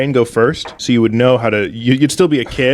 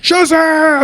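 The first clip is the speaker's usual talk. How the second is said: shouting Shazam. Acted. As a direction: bellow the magic word. Yelling the magic word.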